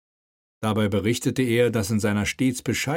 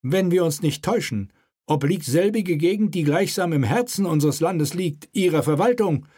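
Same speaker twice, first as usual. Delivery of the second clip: raised, commanding royal voice; king's voice; 8 semitones higher than usual. Acted. King prods the mayor at the table, commanding.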